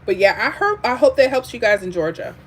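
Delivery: ordinary talk, nothing marked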